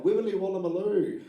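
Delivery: high Aussie gibberish; playful Australian accent babble; mock Australian accent. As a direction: mangle it like a silly Australian accent. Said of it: "everything just sounds like".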